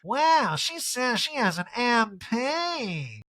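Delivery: high, very slow mock Erica. Erica voiced in recap.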